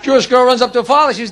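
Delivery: ordinary talk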